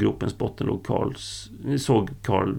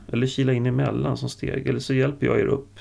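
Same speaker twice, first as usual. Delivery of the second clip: ordinary delivery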